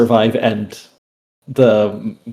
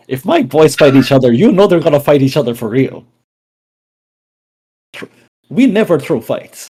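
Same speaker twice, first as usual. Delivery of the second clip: proud defiant; 4 semitones higher, 5 dB louder than usual. Acted. Character defends gang's honor.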